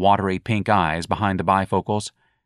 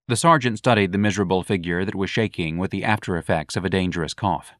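ordinary delivery

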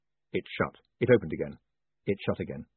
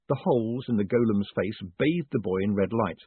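normal talking voice

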